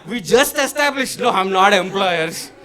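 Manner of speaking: hammed-up indignant objection; indignant protest. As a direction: protest in comic disbelief. Acted protest at driver blaming him.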